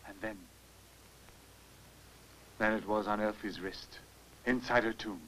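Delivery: low, very soft voice; hushed recollection. Acted. Man telling professor of bracelet in tomb, hushed. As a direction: sink down low and quiet in dread.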